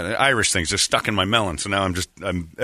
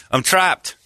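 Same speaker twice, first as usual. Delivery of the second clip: higher, lightly acted plea; Ray; 7 semitones higher than usual. Trainer phoning Johnny for help, higher pleading voice. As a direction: play a stuck caller, pitched up. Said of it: "I got a call from him".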